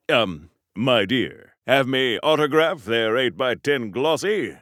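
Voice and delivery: a hint of the old Hollywood charmer; Clark Gable impression. Hero signs autographs as Clark Gable, lightly voiced. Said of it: "Hollywood's favorite leading man".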